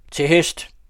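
loud, high call to horse; rally the men with a shout; shouted command. A narrator's reading. Count Hemming rousing his drunken guests, a loud shout. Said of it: "råbte grev Hemming".

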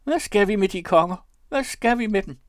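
high, loud falsetto protest; very high-pitched exclamation; squeaking. A narrator's reading. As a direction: pipe it out indignantly. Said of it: "pep Dyber".

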